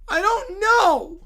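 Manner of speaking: high, slow wailing sob; hammed-up childish crying; mock crying. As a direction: blubber it like a toddler. Kid character crying.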